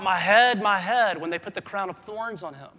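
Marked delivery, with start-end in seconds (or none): 0.0-1.2 loud pained cry, imitated; mock cry of pain. Preacher imitating a cry Jesus never made, loud mock cry. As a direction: cry out as if hurt. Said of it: "he doesn't cry out".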